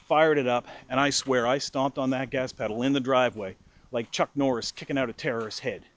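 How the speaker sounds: usual speaking voice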